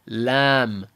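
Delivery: "lam" with a light l and drawn-out vowel; Arabic lam. Shown as a demonstration. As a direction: stretch out "lam", keeping the l light. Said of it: "we finish up by saying am".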